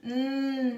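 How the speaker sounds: "Hum" as a long "nnn"; isolated N sound. Shown as a demonstration. Teacher showing the N sound, a sustained "nnn". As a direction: hold "nnn", tongue behind the front teeth. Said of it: "atrás do dente da frente".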